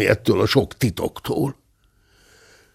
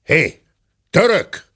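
the gentleman bellows for the coffee man; shouting; 10 semitones higher than usual. Acted. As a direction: yell for the Turk. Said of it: "ordított föl a ténsúr".

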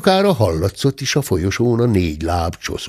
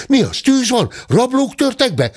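high, louder panicked questions; alarmed questions; 3 dB louder than usual. Acted. The inn staff rushing into the room, alarmed. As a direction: burst in asking in alarm.

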